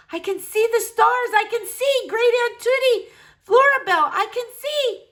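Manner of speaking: high, loud cries of wonder; overjoyed. Acted. Boy crying out to his great-aunt that he can see. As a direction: burst out with joy at seeing. Blind boy regains sight, joyful.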